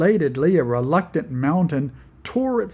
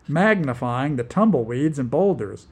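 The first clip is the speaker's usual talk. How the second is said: usual speaking voice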